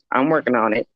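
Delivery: ordinary talk, nothing marked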